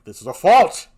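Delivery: loud furious outburst; angry Othello. Acted. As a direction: blast it at her as the raging Moor. Othello angry at lost handkerchief.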